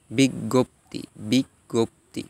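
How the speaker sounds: বিজ্ঞপ্তি as "big-gop-ti"; doubled g. Shown as a demonstration. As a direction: split বিজ্ঞপ্তি into three syllables. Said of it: "উচ্চারণ গয় গজ ফলা হয়".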